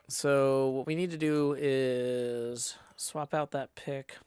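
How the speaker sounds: usual speaking voice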